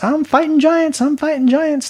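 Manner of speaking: high-pitched mocking war cry; mock bravado. Preacher mocking people who boast of fighting giants, high mock bravado. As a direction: mimic an overexcited giant fighter, voice shooting up.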